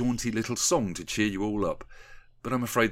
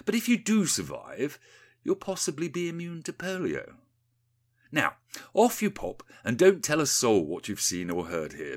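lightly voiced patronising politician, higher and swooping; smug politician; 6 semitones higher than usual. Rees-Mogg sending Windy away, mildly smug menace. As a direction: dismiss them with a veiled threat.

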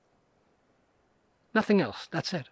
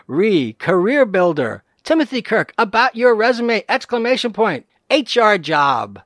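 loud emphatic reread; 3 semitones higher, 6 dB louder than usual. Shown as a demonstration. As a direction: read it again like you can't believe it. Rereading the scam email header.

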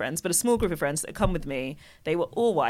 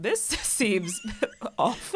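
laughing; 4 semitones higher, 4 dB quieter, much slower than usual. Retold or imitated. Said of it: "I'd been like".

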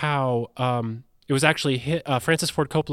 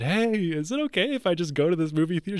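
higher, careful pleading tone; tentative asking; 5 semitones higher than usual. Retold or imitated. Husband asking wife if he can go out, tentative. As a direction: gently float the request, pitched up. Retold timid request to his wife.

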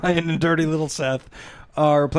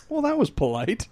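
dry dad quip; 9 semitones higher, 3 dB quieter than usual. Retold or imitated. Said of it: "And Dad goes".

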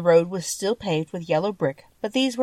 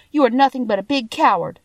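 indignant accusation, higher and louder; retort; 6 semitones higher, 5 dB louder than usual. A narrator's reading. Dorothy calls the lion out, indignant retort. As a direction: accuse as a cross girl. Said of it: "she retorted".

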